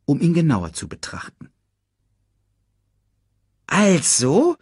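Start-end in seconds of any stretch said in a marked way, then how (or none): 3.7-4.6 hohe, quirlige Erdmännchenstimme; schnatternd. A narrator's reading. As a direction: neugierig hoch drauflos schnattern. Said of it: "schnatterte es". (hoch schnatterndes Erdmännchen)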